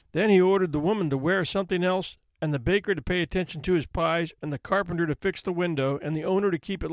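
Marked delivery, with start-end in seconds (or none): none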